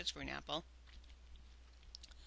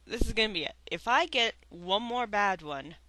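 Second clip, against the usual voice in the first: exasperated; 9 dB louder than usual. Real, not acted. Declares quitting.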